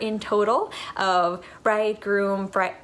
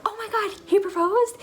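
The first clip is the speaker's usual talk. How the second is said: high, startled squeal of disbelief; gasping "he proposed?", pitched way up; shocked gasp; 12 semitones higher than usual. Retold or imitated. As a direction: play the family's shock at the news. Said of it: "they were like".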